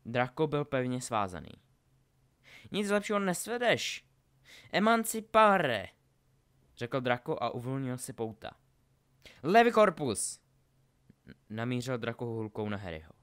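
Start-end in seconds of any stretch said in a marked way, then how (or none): 2.7-5.9 high, loud duelling challenge; shouted taunt and spell. Acted. Draco frees himself from Harry's bonds, shouting. 9.4-10.4 high, loud incantation; shouted spell. Acted. Draco aims his wand at Harry, shouted spell.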